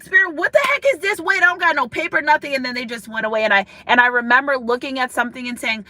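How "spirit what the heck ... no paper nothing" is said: high, fast, exasperated retelling; flustered protest. Retold or imitated. Woman without paper protesting to Spirit, flustered. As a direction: act out being caught unprepared, voice jumping up.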